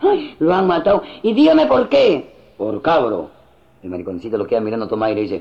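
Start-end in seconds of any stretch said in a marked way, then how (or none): 0.1-2.3 high camp shock, drawn out; hammed-up effeminate man. Effeminate man hearing of a killing, camp dismay. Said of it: "Este mariconcito había un accidente". 2.6-3.3 low, curt answer; deadpan, pitched well down; bystander's deep voice. Acted. Bystander explaining the killing, deep terse voice. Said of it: "contesta el moreno".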